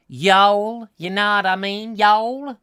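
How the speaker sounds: mock American whine, loud and high; whiny American drawl. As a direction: imitate a whiny American in an advert. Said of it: "in that sort of whiny voice".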